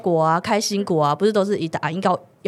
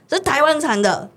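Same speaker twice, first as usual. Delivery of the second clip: retold loud answer; 7 semitones higher than usual. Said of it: "我就讲得很大声".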